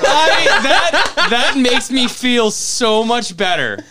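loud, high, slow words through laughter; laughing relief. Real, not acted. Relieved cohost shares the awful situation, laughing loudly. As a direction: gush with relief while laughing.

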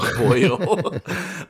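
high, loud mock Irish accent. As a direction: toss it out as a hammed-up Irishman. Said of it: "he does the accent like that".

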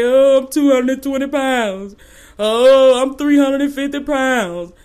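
whiny mock sob, slowed and drawn out; hammed-up pity-party whimper; mock crying. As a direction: whine it like a self-pitying crier. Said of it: "And you sitting there crying".

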